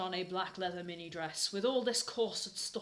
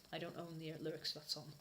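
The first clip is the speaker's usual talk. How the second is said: ordinary delivery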